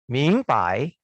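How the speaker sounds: Ming pai said in Mandarin, Ming rising then pai; Mandarin tones. Shown as a demonstration. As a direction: demonstrate Ming pai slowly. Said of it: "jadi kalau digabung ucapannya".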